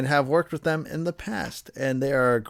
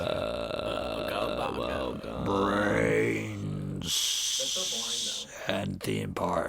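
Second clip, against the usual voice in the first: low groaning zombie voice; zombie moan; 5 semitones lower, 6 dB quieter, much slower than usual. Pretend. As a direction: moan it like the undead. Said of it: "Can you do, like, a zombie voice?".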